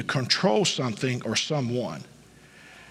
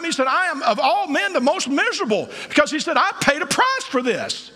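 full-force preaching; 11 semitones higher than usual. Acted. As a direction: preach it to the rafters with rising fire. Preaching climax.

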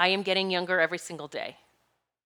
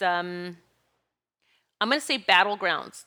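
ordinary talk

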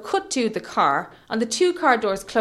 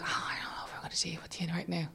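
whispered, far softer; hushed reluctance; 4 semitones lower, 15 dB quieter than usual. Retold or imitated. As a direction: whisper it under your breath. Retelling a weary reaction in hospital.

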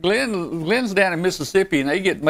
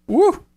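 excited whoop; 3 dB louder than usual. Real, not acted. Cheering about Buc-ee's.

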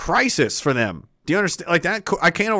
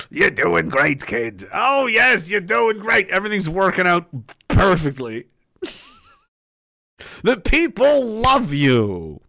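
mock praise; 3 semitones higher, slower than usual.

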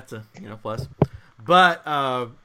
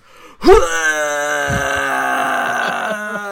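long, loud retching noise; big hammed-up puking sound; imitated vomiting; 9 dB louder than usual. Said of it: "he just starts vomiting".

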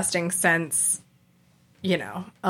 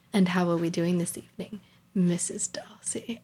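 fast line fading into a whisper on the name; a retold lover's whisper, Missus Darcy breathed; softly whispered endearment; 6 dB quieter than usual. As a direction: murmur it close and intimate, dropping to a whisper. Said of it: "And he's like".

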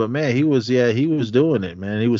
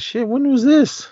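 surprised; 4 dB louder than usual. Retold or imitated. Retold surprised reaction.

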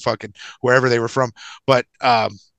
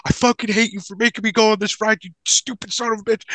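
pitched-up outraged complaint, hammed up; mock furious rant; 7 semitones higher than usual. Injured friend cursing his buddy on the bouncing raft, mock furious. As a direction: whine it like a friend betrayed and jostled.

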